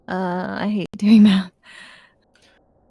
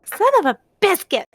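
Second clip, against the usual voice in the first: high-pitched frustrated cry; 9 semitones higher than usual. Real, not acted. Misses, swaps in mild curse.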